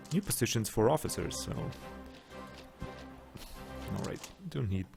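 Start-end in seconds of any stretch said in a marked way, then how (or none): none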